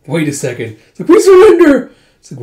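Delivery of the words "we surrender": much higher, loud panicked shout; shouted surrender. Acted. Soldiers begging the warriors, panicked shout. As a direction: scream it out while bowing down.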